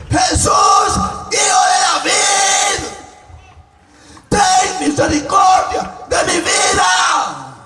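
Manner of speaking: high, drawn-out desperate shout; blind man crying out. Acted. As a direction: plead at full force to be heard. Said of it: "seguía gritando".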